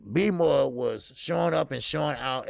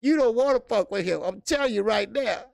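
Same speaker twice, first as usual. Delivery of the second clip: ordinary talk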